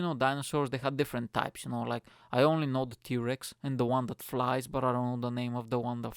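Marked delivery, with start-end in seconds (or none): none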